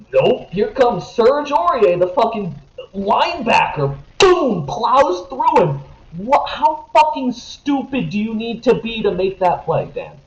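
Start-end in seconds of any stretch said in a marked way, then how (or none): 0.1-8.8 exasperated rant. Real, not acted. Ranting to his co-host about the dumb tackle, exasperated. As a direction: spit out the disbelief at the bad tackle.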